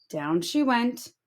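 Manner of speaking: plain reading voice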